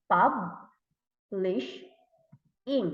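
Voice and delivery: "publish in" as pub, lish, ing with long gaps; syllable by syllable. Shown as a demonstration. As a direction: break "publish in" into three slow beats. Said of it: "there are also three syllables".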